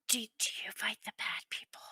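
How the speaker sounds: put-on low, breathy child's whisper. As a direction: hush it like a secret. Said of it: "really low whisper".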